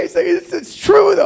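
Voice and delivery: very high mock child wail; crying six-year-old. Six-year-old crying to dad, high wailing imitation. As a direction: stammer tearfully, rising to a loud squeal. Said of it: "She starts to cry".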